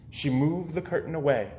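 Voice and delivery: usual speaking voice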